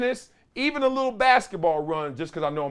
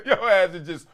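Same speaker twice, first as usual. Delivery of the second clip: ordinary talk, nothing marked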